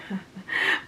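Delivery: short unvoiced laugh; a quick little laugh under the breath; quick breathy laugh. A narrator's reading. Frances laughing off Tom's answer, quick breathy laugh. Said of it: "She laughed quickly".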